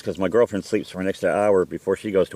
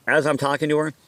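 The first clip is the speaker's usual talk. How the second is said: ordinary delivery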